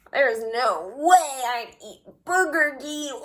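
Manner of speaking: put-on a snooty kid turning up his nose; mocking refusal. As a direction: scoff at the strange food. Kid mocking Justin's lunch.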